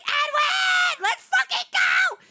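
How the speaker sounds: high screaming fan cheer; very excited shout. Real, not acted. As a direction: scream it like a fan who can't help it. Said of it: "Sorry, I got very excited".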